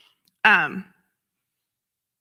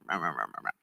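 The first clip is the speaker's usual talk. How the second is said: mock babble; 11 dB quieter, much faster than usual.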